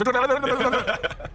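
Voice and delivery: loud, high-pitched gibberish chatter; mock a non-stop talker with a loud, high burst of babble; imitated rapid babble. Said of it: "this girl who worked in my New York office".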